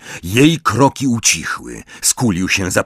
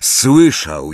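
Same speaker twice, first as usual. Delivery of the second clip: ordinary delivery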